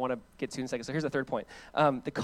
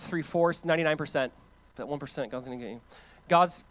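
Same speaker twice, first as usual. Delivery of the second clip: ordinary talk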